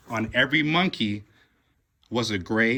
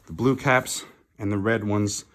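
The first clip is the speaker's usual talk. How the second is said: plain reading voice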